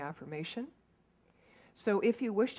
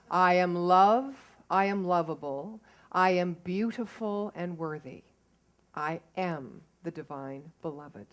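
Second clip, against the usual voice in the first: affirmation read; 7 dB louder than usual. Shown as a demonstration. Speaker shares the affirmation with the congregation, measured reading. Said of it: "I'm gonna say that one more time without you".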